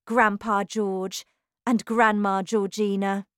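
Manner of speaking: ordinary delivery, nothing marked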